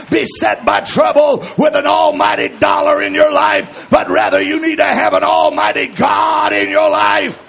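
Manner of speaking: shouted, driving sermon voice; rally the congregation, loud; full-force preaching. Acted. Preacher rising again.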